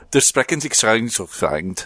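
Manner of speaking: mock German phrase, lightly voiced; German accent. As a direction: say it like fake German. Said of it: "sounds like a U N translator".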